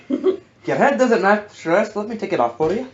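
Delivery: taunting character voice. Retold or imitated. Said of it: "I'm just hitting his tiny head like".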